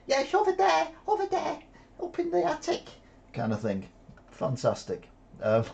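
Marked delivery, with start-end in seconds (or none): 0.1-2.8 put-on very high-pitched little child squeak; squeaky mock-toddler impression. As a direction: play an eager tot giving away a hiding place. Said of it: "if the baby was a little bit older".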